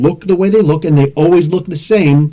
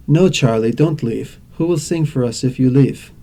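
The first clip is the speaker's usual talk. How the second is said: put-on low, monotone foreign-accented delivery; monotone accented Wonder Woman; 4 semitones lower, 3 dB quieter than usual. Wonder Woman begging Charlie to stay, accented monotone. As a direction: imitate her accent with no expression, pitched down. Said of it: "very beautiful accent with a very monotone voice".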